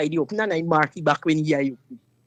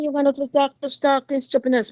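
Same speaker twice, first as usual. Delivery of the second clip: higher, dismissive scolding; retold retort; 8 semitones higher than usual. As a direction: wave his threat away scornfully. Dismissing the boyfriend again.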